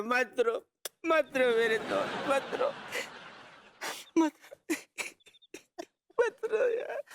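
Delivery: emotional, tearful consoling. Acted. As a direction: beg him not to cry as if about to cry too. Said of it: "खामखा तूने मुझे emotional कर दिया". (भावुक होकर रुआँसी आवाज़ में मनाना)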